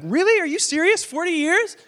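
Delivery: high, loud indignant complaint; loud, high-pitched mock outrage; exasperated Moses. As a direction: rail at God in disbelief. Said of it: "throw his hands up at God and be like".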